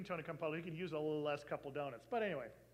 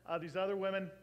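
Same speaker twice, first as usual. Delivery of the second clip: normal talking voice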